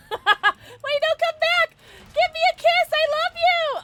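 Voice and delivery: high, loud, laughing call after him; hammed-up high pleading cry; mock pleading shout. Calling after fleeing character.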